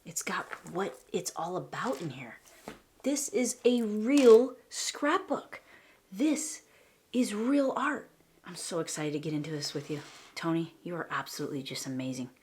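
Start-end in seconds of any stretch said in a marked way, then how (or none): 3.0-5.6 high, loud and slow, stressing "real"; excited emphasis. Real, not acted. Gushing over the student's book. 6.1-8.1 high and loud admiration; awed praise. Real, not acted. Gushing over the book.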